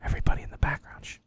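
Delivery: whispered aside to the room; hushed shushing. Real, not acted. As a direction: whisper to hush everyone behind you. Hushing the room while phone rings.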